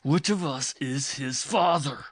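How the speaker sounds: husband shouting the repeated question; loud repeat. A narrator's reading. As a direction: bellow the question now. Repeats louder.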